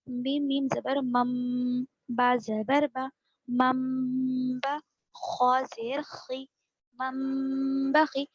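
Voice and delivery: मम बा and मम बखी drawn out with a nasal hum; slow tajweed spelling. Shown as a demonstration. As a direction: spell each syllable slowly, humming the मम. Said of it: "देखिए कैसे हिज्जे कर रहे हैं". (हिज्जे धीरे-धीरे गुन्ना के साथ)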